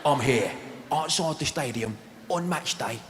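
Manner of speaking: mock Cockney documentary presenter, halting and dramatic; put-on London hard man, slowing at the end; Danny Dyer. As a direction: present to camera in short, weighty phrases. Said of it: "he's talking to the camera".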